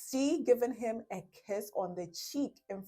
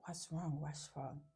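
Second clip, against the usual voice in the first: softer, hushed repeat; 3 semitones lower, 11 dB quieter than usual. Retold or imitated. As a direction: ask it low so others won't hear. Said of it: "he says something like".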